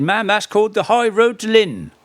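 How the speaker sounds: high-pitched Norfolk countryman's voice; Norfolk accent. Retold or imitated. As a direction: imitate a Norfolk local, pitched well up. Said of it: "coming from Baconsthorpe".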